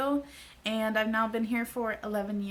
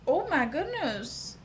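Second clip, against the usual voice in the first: delighted gasp; 5 semitones higher than usual. Retold or imitated. Delighted by her cheekbones.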